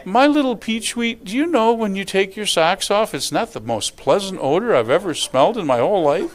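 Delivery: cushion the complaint with pet-name sweetness; mock-sweet gentle approach; tactful sweet-talk. Shown as a demonstration. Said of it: "there's a way to say things".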